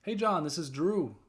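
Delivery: confident greeting. Shown as a demonstration.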